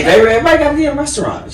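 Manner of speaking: playful high voice. Retold or imitated. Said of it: "I was like".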